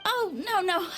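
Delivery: high, flustered backpedal. Acted. Donna flustered, taking back her words to the captain.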